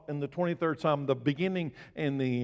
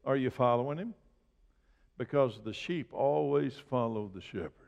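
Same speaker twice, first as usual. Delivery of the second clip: usual speaking voice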